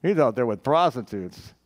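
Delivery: low-pitched, knowing mock grumble; lightly acted, low thinking-to-himself voice; suspicious inner thought. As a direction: voice a jealous brother's private suspicion. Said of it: "Probably thinking".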